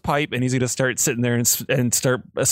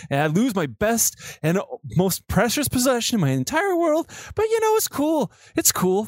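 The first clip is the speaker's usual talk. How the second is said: much higher, mock-cheery sarcasm; sarcastic sulk; 9 semitones higher than usual. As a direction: sneer it as if it were fine. Silver's self-pitying sarcasm climaxes.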